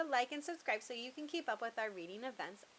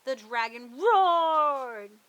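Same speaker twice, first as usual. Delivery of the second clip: slow and much louder; roaring narration; 3 semitones higher, 10 dB louder, much slower than usual. A narrator's reading. Narrator telling the dragon's roar, slow and loud. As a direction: let the roar fill the room. Dramatic story climax.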